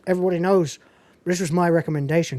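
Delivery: usual speaking voice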